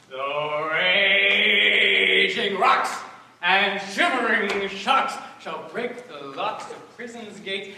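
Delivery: slow, loud, sung ranting of a tyrant; tyrant's vein. Acted. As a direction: boom it out like a stage tyrant. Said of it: "this is Hercules' vein, a tyrant's vein".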